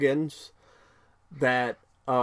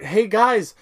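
higher, louder cheerful announcement; chirpy Amy; 6 semitones higher, 5 dB louder than usual. Retold or imitated. Amy telling the guys about the bands, chirpy and loud. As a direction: proudly show off the rubber bands. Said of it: "Amy's like".

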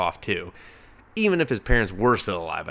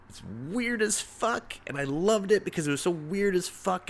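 delighted; 10 semitones higher than usual. Real, not acted. Gushing about odd comic.

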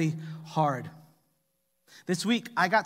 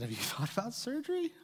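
lightly voiced son, a touch higher and softer; son's innocent question; 5 semitones higher, 12 dB quieter than usual. Son innocently asking his balding dad about surgery, light. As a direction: ask it innocently, with a little laugh. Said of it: "And he said".

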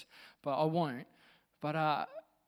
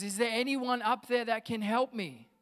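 higher, louder call up from a pit; crying out for help; 6 semitones higher, 5 dB louder than usual. Acted. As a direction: call up for anyone who can help. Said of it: "Joe just cries out".

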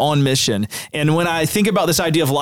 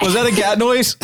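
laughing; 8 semitones higher than usual. Real, not acted. Host laughing at cat noise.

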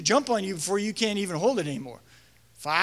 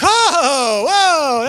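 loud startled whoa, pitched up; 6 semitones higher, 9 dB louder than usual. Retold or imitated. Youth leader recalling kids leaping on him, startled loud whoa. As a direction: brace as kids crash onto you.

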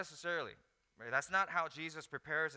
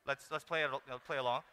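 ordinary delivery

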